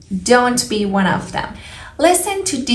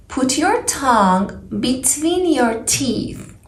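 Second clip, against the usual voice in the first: ordinary talk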